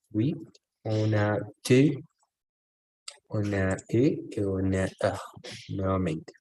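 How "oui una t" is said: ordinary talk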